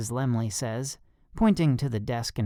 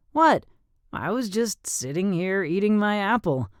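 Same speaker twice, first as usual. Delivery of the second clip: mock innocent; 8 semitones higher than usual. A narrator's reading. Feigning innocence.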